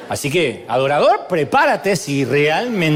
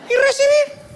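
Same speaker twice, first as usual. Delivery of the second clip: agudo burlón. Acted. Burla de quien no sirve.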